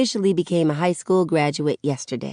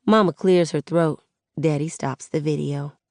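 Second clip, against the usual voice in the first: ordinary delivery, nothing marked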